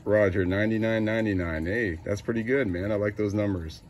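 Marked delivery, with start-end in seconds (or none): none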